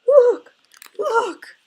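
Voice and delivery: very high, very loud tiny bear cub cry; piercing, high-pitched little cub wailing in alarm; wee little voice. A narrator's reading. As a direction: squeal it out as a panicked baby bear. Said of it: "he cried in his wee little voice".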